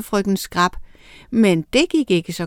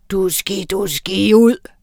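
screaming spell. A narrator's reading. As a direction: scream the last word out. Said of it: "skreg hun".

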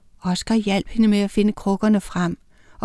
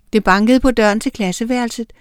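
plain reading voice

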